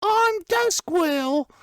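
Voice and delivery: put-on very high-pitched little elf kid; Duskwirl. Shown as a demonstration. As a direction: squeak it like a tiny British boy. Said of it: "that's Duskwirl right there".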